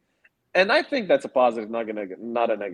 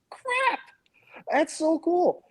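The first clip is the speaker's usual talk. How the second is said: high, thrilled gush; overjoyed fan squeal; geeked out; much slower than usual. Real, not acted. Gushing over the scene.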